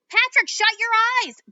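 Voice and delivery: high, loud warning yell; urgent shout. A narrator's reading.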